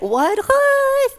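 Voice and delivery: very high squeaky puppet voice; high-pitched, drawn-out bewilderment; baffled puppet. Acted. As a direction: squawk the confusion in a silly puppet voice. Puppet plays baffled disciples.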